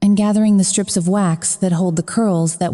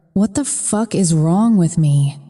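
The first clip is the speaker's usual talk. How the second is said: ordinary delivery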